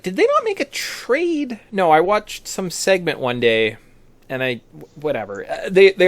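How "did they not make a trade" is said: high-pitched, drawn-out disbelieving question; incredulous. Retold or imitated. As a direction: voice jumps up in mock disbelief. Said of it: "I was like".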